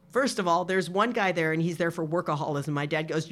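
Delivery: ordinary delivery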